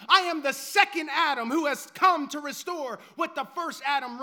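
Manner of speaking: loud, driving preacher's cadence; Jesus declaring himself to Saul, loud and driving; preaching at full force. Acted. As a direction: hammer each I am home.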